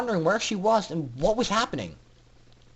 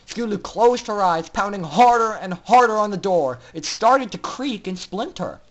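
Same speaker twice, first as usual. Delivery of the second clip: plain reading voice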